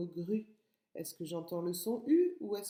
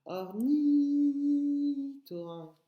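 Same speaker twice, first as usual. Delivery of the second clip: Ornithorynque higher and louder, ni held long; "Ornithorynque" slow, the second syllable sustained; teeth-baring long I; 7 semitones higher, 6 dB louder, much slower than usual. Shown as a demonstration. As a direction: stretch ni in "Ornithorynque", lips spread. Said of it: "je monte mes dents quand je le dis".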